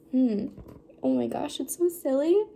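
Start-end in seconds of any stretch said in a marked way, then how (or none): none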